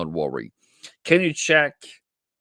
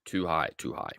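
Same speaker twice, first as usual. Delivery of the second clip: muttered to himself; 4 semitones lower, 7 dB quieter than usual. Real, not acted. Trader scolding himself for his entry, soft lower mutter.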